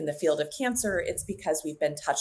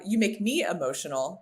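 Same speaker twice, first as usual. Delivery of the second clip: usual speaking voice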